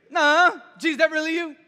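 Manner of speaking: high, loud, doubting shout; calling out. Acted. Disciple on the boat checks it is Jesus, calling out. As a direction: holler the question up and out, half unsure.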